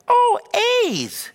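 very high, loud "Oh", then dropping; delighted mom impression. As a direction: squeal it like a thrilled mom. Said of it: "That's how she talks".

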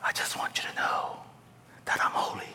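breathy whisper to Moses; hushed teasing whisper; mock whisper. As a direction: whisper it as a silly joke. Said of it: "me going up to this Moses going".

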